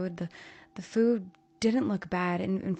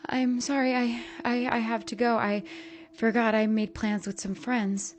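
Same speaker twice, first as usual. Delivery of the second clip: nervous excuse; 4 semitones higher, 4 dB louder than usual. A narrator's reading. Daughter fleeing nervously.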